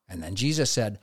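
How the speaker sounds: ordinary talk, nothing marked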